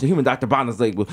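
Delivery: normal talking voice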